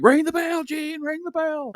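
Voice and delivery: high, put-on yelling referee voice; playful high-pitched referee impression; mock referee yell. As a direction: tease him like the angry referee. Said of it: "people constantly joke".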